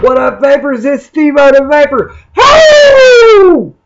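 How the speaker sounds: loud, high, pumped-up show intro; hype TV host shout. Pretend. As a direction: yell it like a car-show host. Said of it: "I've been watching a little too much Fast and Loud".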